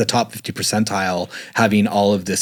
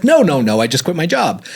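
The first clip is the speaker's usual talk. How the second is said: higher, quick no-no-no; hasty denial; 7 semitones higher, 4 dB louder than usual. Retold or imitated. Husband denies the affair to his wife, high and hurried. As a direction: wave off the accusation.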